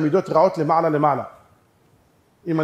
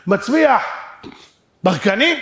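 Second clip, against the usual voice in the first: high, loud query, pause before "ברקנים"; raised challenge, "ברקנים" as a question; insistent question; 5 semitones higher, 5 dB louder, slower than usual. Acted. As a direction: ask it louder, lifting "ברקנים". Mounting question.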